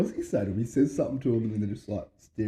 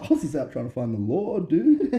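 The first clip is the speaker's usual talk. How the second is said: louder, cracking up by the end; laughing quote; 8 semitones higher, 6 dB louder than usual. Retold or imitated. Theo explaining the cult photo, laughing as he says it. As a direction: let the laugh break through on dude. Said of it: "He's like".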